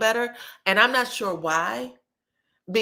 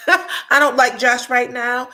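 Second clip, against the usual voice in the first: louder and slower, laughing through it; real laugh, louder and drawn out; 4 semitones higher, 8 dB louder, slower than usual. Real, not acted. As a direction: laugh it out while saying it. Repeats line, then laughs.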